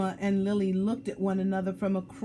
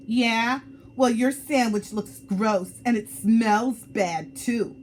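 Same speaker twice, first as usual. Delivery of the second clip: louder, hurt retort; snapping back; 4 semitones higher, 7 dB louder than usual. Acted. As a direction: hit back at your friend. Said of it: "Salma snapped back".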